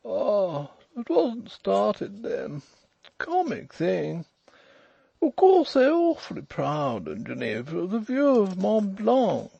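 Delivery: high, slow, overbearing drawl; stretched, high-pitched toff voice. A narrator's reading. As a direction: ask it with lordly slowness. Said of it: "drawling, overbearing fellow with an eyeglass".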